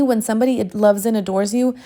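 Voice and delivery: normal talking voice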